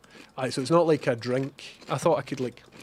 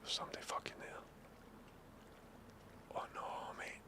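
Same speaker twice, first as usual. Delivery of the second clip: tense hushed whisper; 6 semitones higher, 26 dB quieter, much faster than usual. Real, not acted. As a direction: whisper so they can't hear. Sneaking near stranger in game.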